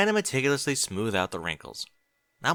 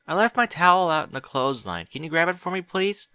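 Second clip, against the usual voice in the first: put-on higher, brighter-pitched young mare voice; Twilight; 8 semitones higher, 4 dB louder than usual. A narrator's reading. Twilight asking Applejack for her towel, higher voice. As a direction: ask a favour from behind the door.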